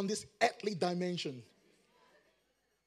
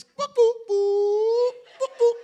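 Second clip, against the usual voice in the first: very high, loud crying boo; comic imitation of weeping; mock wailing boo; 12 semitones higher, 9 dB louder, slower than usual. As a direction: mimic bawling with high-pitched boos. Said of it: "All I'm doing is".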